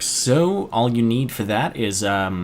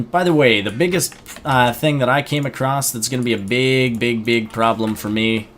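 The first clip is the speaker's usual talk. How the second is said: normal talking voice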